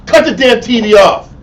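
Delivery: loud, high, angry command; exasperated order. Acted. Teacher to household watching TV during the lesson, exasperated shout. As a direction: snap the order out loudly.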